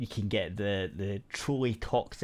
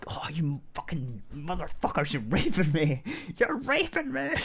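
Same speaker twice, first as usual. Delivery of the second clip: high mock shriek climbing higher at the end; imitated shouting; 6 semitones higher than usual. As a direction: mimic an over-excited gamer shrieking curses. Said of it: "And she was shouting it out".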